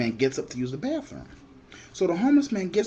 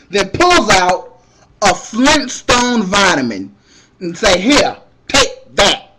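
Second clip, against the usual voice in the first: louder, slow deadpan reveal; slow punchline build; 4 semitones higher, 9 dB louder, slower than usual. Retold or imitated. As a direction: drag out the punchline. Punchline about a vitamin.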